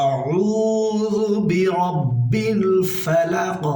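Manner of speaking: slow, melodic chanted recitation; reciting Surah Falaq for students; Quran recitation. Shown as a demonstration. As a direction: stretch each word in chant. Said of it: "তালুর সঙ্গে লাগিয়ে কফ".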